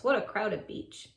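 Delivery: ordinary delivery, nothing marked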